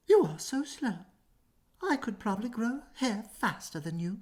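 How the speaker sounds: high, cocky rabbit jeer; mock the tortoise from up high; taunting rabbit. Rabbit teasing Tortoise, high and cocky. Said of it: "the road and said".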